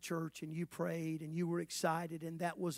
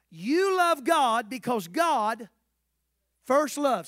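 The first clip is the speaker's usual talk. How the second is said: louder, slow, stressing first; emphatic slow proclamation; 5 semitones higher, 10 dB louder, much slower than usual. Shown as a demonstration. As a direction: drive the word first home. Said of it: "Somebody say first loved".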